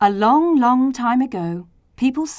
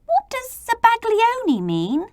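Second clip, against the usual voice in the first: much higher curious question; inquiring. A narrator's reading. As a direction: ask it in a high small voice. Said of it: "I inquired".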